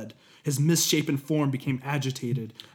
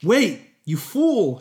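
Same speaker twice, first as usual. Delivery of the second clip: much higher, louder, slow yell; angry shout; 8 semitones higher, 6 dB louder, much slower than usual. Acted. Hephaestus yelling at fleeing apprentice, angry shout. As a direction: yell after the fleeing thief. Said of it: "shouted Hephaestus".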